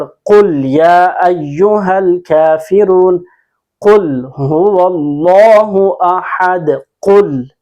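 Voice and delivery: कुल हुवल्लाहु अहद chanted slowly with heavy Qaf and long "Allaah"; measured tajweed recital, bouncing the end of अहद; full-mouthed, drawn-out recitation of कुल हुवल्लाहु अहद; Quran recitation. Shown as a demonstration. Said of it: "चार कुल पढ़".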